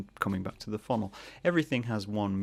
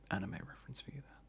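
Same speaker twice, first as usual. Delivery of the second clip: a quick hushed aside; whispered aside; 3 semitones higher, 14 dB quieter, faster than usual. Real, not acted. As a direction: whisper it, much softer. Whispered aside about Akira.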